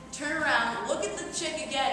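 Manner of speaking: usual speaking voice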